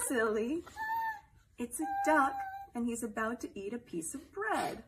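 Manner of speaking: bright, playful read-aloud voice; reading to a toddler. Acted. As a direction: read it like a bedtime picture book for little ones. Celebrity reads picture book to kids.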